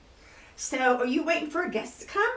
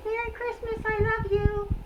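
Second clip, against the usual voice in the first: lightly raised pet puppet voice; sweet little puppet greeting, pitched up; 8 semitones higher than usual. Pretend. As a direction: puppet monkey says hi to the kids, a touch higher. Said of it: "say Merry Christmas to the kids".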